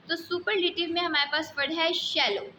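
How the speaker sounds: usual speaking voice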